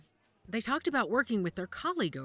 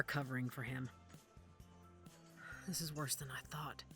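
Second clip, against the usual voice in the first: whispered, much softer aside; hushed dismay; 4 semitones lower, 13 dB quieter than usual. Acted. Judith taking in how bad things are, hushed dismay. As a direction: whisper it to yourself. Murmurs grim realization to herself.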